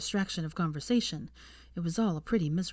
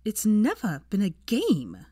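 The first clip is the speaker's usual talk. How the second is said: slow, louder, disgusted retort; spat in disgust; 4 semitones higher, 5 dB louder, slower than usual. A narrator's reading. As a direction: spit the words out in revulsion. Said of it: "she spat, repulsed".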